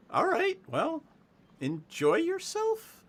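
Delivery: very high, drawn-out humouring voice; doubtful deputy. Acted. Deputy humours vacationers, high and doubtful. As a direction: humour them in a high, slow voice.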